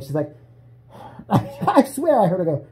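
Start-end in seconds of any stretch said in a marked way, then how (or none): none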